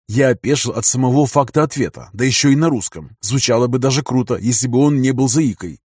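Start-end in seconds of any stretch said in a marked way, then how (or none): none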